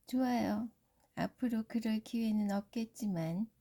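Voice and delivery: loud, mocking aside; sneering princess. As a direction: scoff behind a polite smile.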